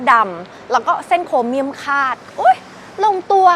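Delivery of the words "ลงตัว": excited. Real, not acted. ชมไฟท้ายรถอย่างตื่นเต้น.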